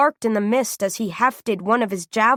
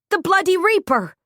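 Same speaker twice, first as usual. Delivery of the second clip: loud, high outcry; crying out; 9 semitones higher, 3 dB louder than usual. A narrator's reading. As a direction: shout the name in alarm. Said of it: "Jules cried".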